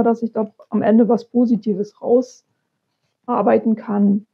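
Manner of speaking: ordinary talk, nothing marked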